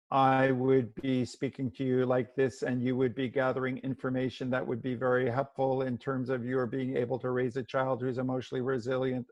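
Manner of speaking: flat, even monotone delivery; adult language. Shown as a demonstration. Demonstrating adult language to parents, in a monotone. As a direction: drone it out evenly like dry information. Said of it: "if I were really talking to you in adult language".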